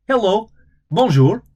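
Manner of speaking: high, loud quick hello; chirpy fast clerk voice; rapid clerk greeting. Acted. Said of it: "then spoke rapidly".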